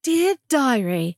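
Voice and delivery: drawn out very slowly; slow storybook. Pretend. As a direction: read it like opening a diary. Echoing the diary opening.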